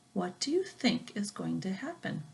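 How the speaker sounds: hushed whisper of suspense. Real, not acted. Storyteller asking the children what happens next, whispered.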